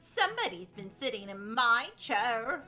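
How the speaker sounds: mother bear voice. A narrator's reading. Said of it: "said the mother bear".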